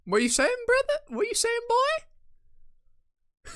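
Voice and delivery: very high squeaky questioning; squeaked, incredulous queries; mock disbelief. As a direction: pitch it way up, each phrase rising.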